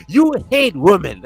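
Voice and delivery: mock accusation. Mock accusation in retold exchange.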